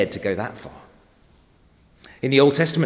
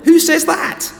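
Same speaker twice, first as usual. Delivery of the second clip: amazed outburst; 9 dB louder, slower than usual. Real, not acted. Preacher's amazed rhetorical outburst.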